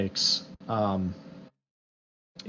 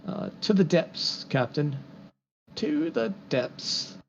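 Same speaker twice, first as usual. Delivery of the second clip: high, excited gnome-ish chatter; chirpy sailor voice, rising then fading; high-pitched Dagrid voice; 6 semitones higher than usual. Acted. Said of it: "and says".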